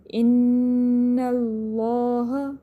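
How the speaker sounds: very slow ان اللہ with hummed noon and thick l; heavy laam. Shown as a demonstration. Teacher recites for students, ان اللہ with a full, heavy laam. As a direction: hold the n, then darken the laam in ان اللہ. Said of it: "موٹا پڑھنا ہے".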